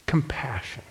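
hushed whisper, voice dropped away. Acted. Preacher offering Paul's word to the congregation, whispered. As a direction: breathe the word out like a secret gift.

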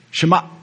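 "Shema" with the throat shut at the end; glottal stop ending. Shown as a demonstration. Preacher teaching the Hebrew: "Shema" with a throat-stopped end. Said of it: "That's how you say it".

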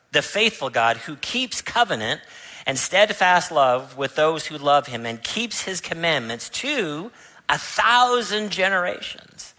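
ordinary talk

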